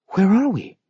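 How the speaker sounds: high, bewildered woman's voice; ask, just waking up; Sylvia's voice. A narrator's reading. Sylvia dazed, peering out.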